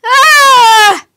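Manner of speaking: long high screaming yell; frightened yell. A narrator's reading. Mike caught by the laughing girls, long terrified yell. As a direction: scream in terror without stopping. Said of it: "couldn't stop yelling".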